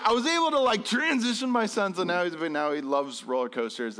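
usual speaking voice

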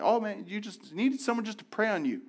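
imitating someone blaming lack of faith; preachy judgment. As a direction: parody a judgmental churchgoer. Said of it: "We'll say things like".

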